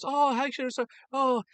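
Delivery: high-pitched mock Japanese chef; heavy Japanese accent. Hibachi chef fussing over guests, heavy mock Japanese accent. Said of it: "you know, a very heavy accent".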